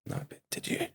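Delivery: hushed, confused exchange between puzzled listeners; baffled aside. Retold or imitated. Listeners look to each other for translation, hushed and baffled. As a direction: drop to a near-whispered aside. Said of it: "they'd look to each other for translation".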